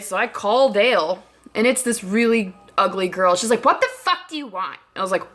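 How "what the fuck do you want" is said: higher-pitched, irritated mimicry; mock annoyed retort; hostile phone answer. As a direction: imitate a snippy friend picking up the phone.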